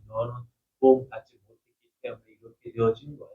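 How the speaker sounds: low, hushed tempter's voice; a lightly voiced devil murmuring doubt; devil's whisper. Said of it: "하고 계속 푹푹 찌를 때". (낮고 조용한 마귀의 속삭임)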